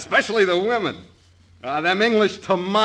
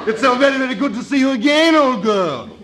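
high, hammed-up English greeting, first word stretched loud; affected English accent; 6 semitones higher, 4 dB louder, slower than usual. Guitarist greets bandleader's wife, trying out affected English accent. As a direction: gush in a mock English accent, pitched up. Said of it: "That's just trying out the accent".

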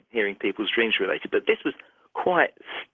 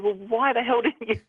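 high-pitched, drawn-out mock exasperation; retold scolding, pitched well up; exasperated reproach; 9 semitones higher than usual. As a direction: imitate a colleague scolding in disbelief.

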